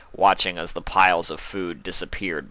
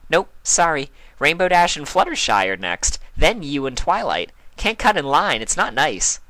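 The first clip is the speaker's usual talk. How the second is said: higher, bubbly pony voice; lightly voiced cheerful pony; Pinkie's voice; 8 semitones higher, 4 dB louder than usual. A narrator's reading. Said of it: "Pinkie told her".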